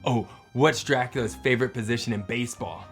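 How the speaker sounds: ordinary delivery, nothing marked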